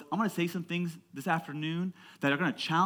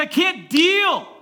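high, loud, drawn-out wail; mock anguished yell; 12 dB louder, much slower than usual. Teen confessing he can't sell, mock anguished yell. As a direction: imitate a kid yelling in despair. Retold outburst of upset teen.

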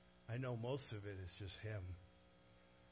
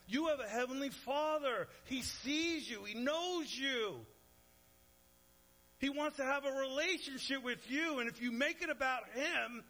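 higher and louder, fervent and urgent; pleading call; 12 semitones higher, 7 dB louder than usual. Retold or imitated. Jesus urging the crowd to seek their Father, fervent loud plea. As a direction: plead with them, rising to full force.

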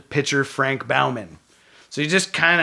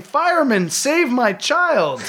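high, drawn-out mock cry for help; slow, high-pitched melodramatic plea; screaming woman, mock; 10 semitones higher, slower than usual. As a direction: imitate a distressed woman without really screaming. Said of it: "and a woman screaming".

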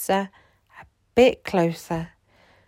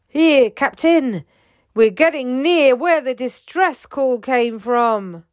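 higher, louder eager crewman; crew member reporting; 6 semitones higher, 6 dB louder than usual. A narrator's reading. Crewman tells the captain they are near the call, eager.

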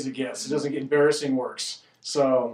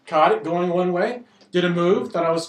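usual speaking voice

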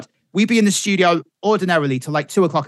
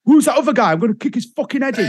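high, fast mock outburst; acted rage, breaking into laughter; angry husband; 9 semitones higher, faster than usual. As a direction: imitate a furious man threatening the prankster. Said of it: "When people get really angry".

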